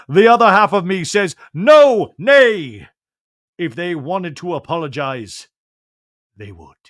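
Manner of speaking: grand, loud stage declaiming; Shakespearean voice. Pretend. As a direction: overact a tragic speech. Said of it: "but in a Shakespearean voice".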